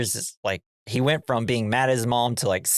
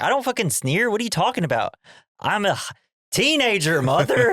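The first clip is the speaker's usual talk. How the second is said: high, whiny-pitched imitation of a teen; hammed-up adolescent protest; exasperated teenager; 9 semitones higher than usual. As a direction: act out an indignant son arguing with mother. Acted quarrel, exasperated teenage son.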